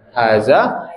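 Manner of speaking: slow, careful Arabic model phrase; teacher's slow demo; slow model reading. Shown as a demonstration. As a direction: say it slowly for students to repeat. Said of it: "Haza baitun".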